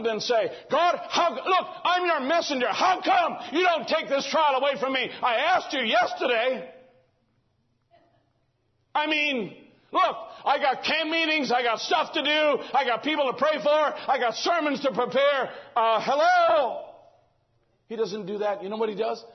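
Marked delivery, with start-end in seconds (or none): 0.7-6.7 high, loud indignant complaint to God; mock prisoner grumbling at God; whiny complaint. Prisoner complaining to God in a high indignant voice. 8.9-15.5 high, pleading list of busy excuses; mock complaint. Overworked preacher whining to God with mock pleading. 15.7-16.8 slow, high, loud sarcastic hello; mock indignant. Prisoner sarcastically calling out to God, slow and loud.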